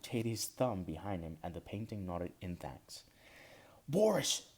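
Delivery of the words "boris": sharp high shout of a name. Acted. Beagle painting yelling at Boris as he runs, loud shout. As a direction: yell after him across the room. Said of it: "shouted the beagle".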